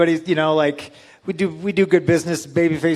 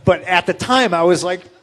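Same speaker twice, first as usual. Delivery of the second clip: ordinary talk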